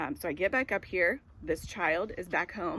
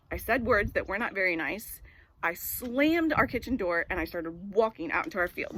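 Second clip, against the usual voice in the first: usual speaking voice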